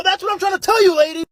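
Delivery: very high, loud outburst; exasperated yell. Acted. Worker to persistent broccoli customer, exasperated yell. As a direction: yell it as if patience has finally run out.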